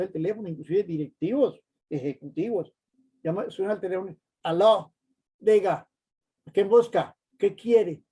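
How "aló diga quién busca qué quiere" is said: loud, curt and brusque; rude phone answer. Retold or imitated. Executive answering the phone rudely, loud and curt. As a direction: snap at the caller. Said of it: "Uno ve un ejecutivo hablando así".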